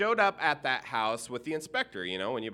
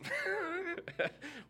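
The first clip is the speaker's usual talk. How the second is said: a laugh that breaks into a high cry; high laughing squeal; 7 dB quieter than usual. Real, not acted. Minister laughing at the huge dog, high squealing laugh.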